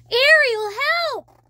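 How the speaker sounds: high, loud panicked call; cry for help. Acted. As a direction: scream for help from the net. Flounder caught in net.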